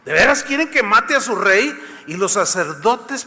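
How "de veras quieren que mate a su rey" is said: sarcastic. Acted. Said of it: "Y así sarcástico".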